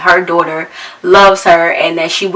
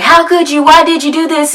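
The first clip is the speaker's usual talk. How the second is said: much higher, hurt and accusing; upset kids; 9 semitones higher than usual. Retold or imitated. Kids confronting their mom, high and hurt.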